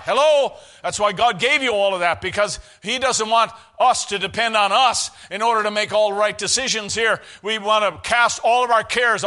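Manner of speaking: loud, driving exhortation; full-force preaching. Real, not acted. Preacher telling church to cast cares on God, full-force preaching.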